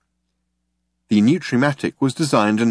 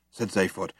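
ordinary delivery, nothing marked

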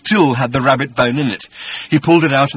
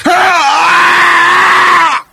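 huge, high bestial "Ah!", much louder; creature exclaiming; 10 dB louder than usual. Acted. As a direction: hurl the "Ah!" out like a monster's cry. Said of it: "exclaimed Agrajag".

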